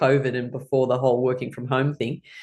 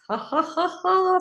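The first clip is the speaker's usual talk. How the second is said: high, deliberate ha-has; high spaced laughter; exercise laughter; slower than usual. Shown as a demonstration. Laughing about getting visas.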